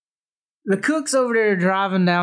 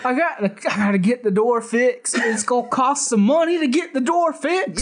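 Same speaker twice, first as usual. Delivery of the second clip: lightly voiced cook; 6 semitones higher than usual. S0 quotes the cook.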